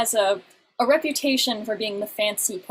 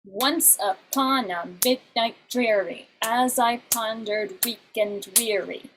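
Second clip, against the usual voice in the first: slow, stressed beats first in each pair; "Once upon a midnight dreary" with the trochee beat; trochaic recital; slower than usual. Shown as a demonstration. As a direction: read the Raven line slowly, beat by beat. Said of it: "trochaic octameter".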